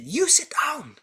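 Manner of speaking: high, loud barked order; teacher ordering the child to sit; stern teacher. Retold or imitated. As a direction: bark the pupil back into the seat. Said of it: "She said".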